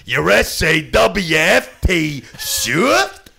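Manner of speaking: a slow, loud, high-pitched promo taunt; spelled-out taunt. Retold or imitated. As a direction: spell it out slowly, then sell shift loud and high. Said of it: "I was like".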